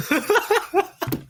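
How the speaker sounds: breaking into high laughter; can't hold back a giggle. Real, not acted. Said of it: "Say it without laughing".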